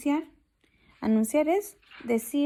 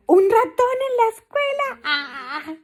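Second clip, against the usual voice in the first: very high, loud mocking lizard voice; high loud disbelieving character voice; scornful lizard; 11 semitones higher, 8 dB louder, slower than usual. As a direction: scoff in a shrill lizard voice.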